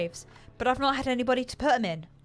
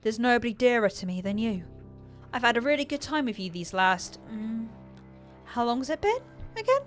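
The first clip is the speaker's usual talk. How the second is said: higher and louder, breezy and empty; worryingly offhand; 5 semitones higher, 5 dB louder than usual. A narrator's reading. Gravedigger cheerfully reassuring her victim: empty and offhand. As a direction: chatter sweetly with nothing behind it. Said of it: "her voice still worryingly offhand".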